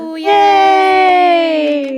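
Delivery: high gleeful cheer; excited cheer. Real, not acted. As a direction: cheer like breaking in is fun. Group cheering.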